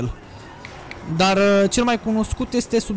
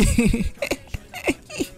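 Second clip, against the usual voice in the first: high giggle trailing into a squeal; giggling laugh; 6 semitones higher, 3 dB quieter than usual. Real, not acted. Gamer amused at his own outburst, giggling.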